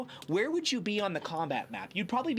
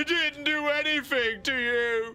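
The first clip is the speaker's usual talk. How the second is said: whiny giant; 9 semitones higher, 4 dB louder, slower than usual. Acted. Giant replies to intimidation.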